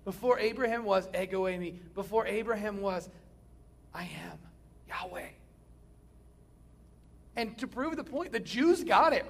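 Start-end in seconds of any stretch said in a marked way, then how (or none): 3.9-5.3 breathy reverent whisper of the divine name. Acted. Preacher whispering Jesus' claim to the congregation, reverent whisper. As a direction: hush it like a sacred secret.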